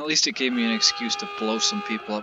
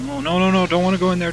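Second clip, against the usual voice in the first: higher, louder panicked pleading; mock panic; 6 semitones higher, 8 dB louder, faster than usual. Real, not acted. Gamer refusing the vent, loud mock-panicked pleading. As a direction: beg not to go in there, half-joking.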